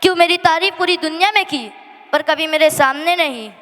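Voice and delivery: ordinary talk, nothing marked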